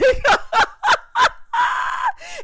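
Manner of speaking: a high whooping laugh that nearly shouts; loud shrieking laugh. Real, not acted. Recapper howls at Bria's wrong word, loud shrieking laugh.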